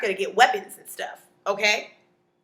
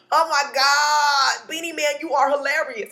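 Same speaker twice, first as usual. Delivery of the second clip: drawn-out, loud, high delight; hammed-up amused exclamation; tickled gushing; 5 semitones higher, 5 dB louder than usual. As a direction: gush as if hugely amused. Gushing at visitor's joke.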